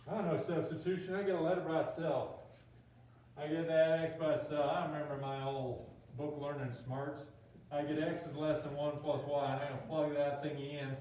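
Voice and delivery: folksy Southern student voice; Southern accent. Acted. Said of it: "I click into Southern accents".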